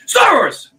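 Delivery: sudden high loud shout of the punchline; exclaimed punchline. Real, not acted. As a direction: blurt the answer out like a gotcha. Punchline naming the cliché source.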